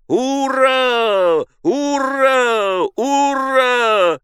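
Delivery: long, high drawn-out hurrah; sustained high cry; crowd cheer. A narrator's reading.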